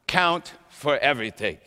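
usual speaking voice

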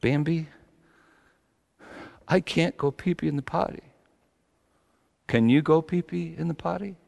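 a hint of a tearful toddler to his doll; child's voice. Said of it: "He said".

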